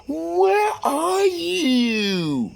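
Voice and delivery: very high, slow, drawn-out whine; mistress voice. Retold or imitated. Mistress on the phone demanding where he is, drawn-out high whine. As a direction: stretch it out high like a needy mistress.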